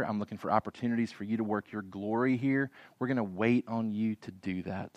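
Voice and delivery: low, quiet trust in God; humble prayer. Acted. King looking above his circumstances to God, humble and hushed. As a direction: pray it softly and humbly, as the king. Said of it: "he's crying out in humility to God".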